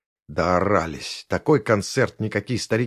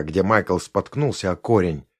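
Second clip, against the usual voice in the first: ordinary delivery, nothing marked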